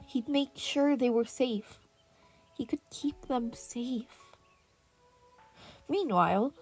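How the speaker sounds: ordinary delivery, nothing marked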